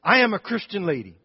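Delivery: put-on high, loud stern old lady; stern grandmother. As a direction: scold him firmly like a grandmother. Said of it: "She said".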